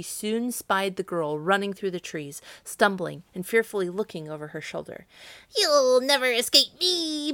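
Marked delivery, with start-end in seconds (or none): 5.6-7.3 loud, high, slow witch cry; menacing witch screech; Baba Yaga. A narrator's reading. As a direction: screech the threat, stretching it out. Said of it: "laughed a terrible laugh".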